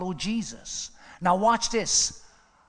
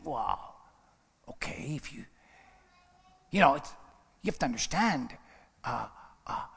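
lower, softer hemming and hawing; hesitant dodge; 6 semitones lower, 5 dB quieter than usual. Retold or imitated. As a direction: mumble it like a man avoiding the answer. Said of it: "possibly saying".

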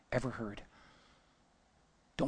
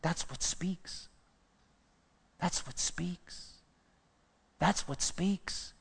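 soft, slow near-whisper; hushed emphasis; 8 dB quieter, slower than usual. Real, not acted. Preacher driving home the point, soft and slow. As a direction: let it sink in quietly.

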